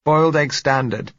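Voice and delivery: acted call from another room, pitched high; muffled voice. A narrator's reading. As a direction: answer from behind a closed door. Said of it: "said her muffled voice".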